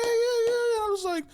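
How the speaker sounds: words breaking into laughs; laughing through the memory. Real, not acted. Laughing at his own story.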